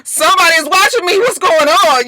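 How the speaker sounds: high, loud panicky voice; jittery mock panic; mock scared. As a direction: play up the fright. Retold scared character voice.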